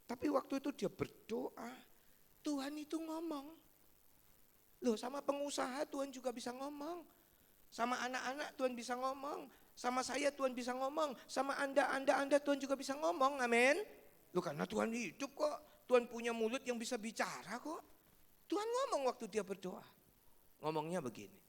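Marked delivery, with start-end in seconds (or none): 4.8-16.7 high, fast, building preacher's call; fervent preaching building to amen. Acted. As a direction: drive it home to the congregation. Preaching at rising force.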